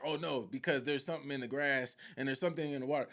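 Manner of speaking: low, soft quoted voice, lightly played; quoting the character. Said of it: "He like".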